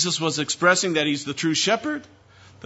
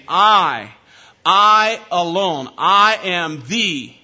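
louder, slow, pressing on I alone; emphatic I alone; 6 dB louder, much slower than usual. Shown as a demonstration. Preacher quoting Jesus to Pharisees, loud and slow. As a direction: hammer I, I alone, each word apart. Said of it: "he used very emphatic language".

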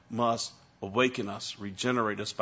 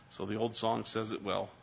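ordinary talk

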